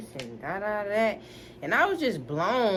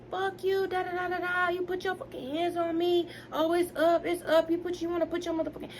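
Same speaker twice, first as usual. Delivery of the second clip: much higher, heated mock yelling, rushing at the end; cussing him out, pitched way up; retold angry rant; 9 semitones higher than usual. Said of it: "like, I was going in".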